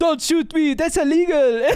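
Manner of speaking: lightly imitated South African accent; a hint of a South African accent. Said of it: "a South African accent he kept doing".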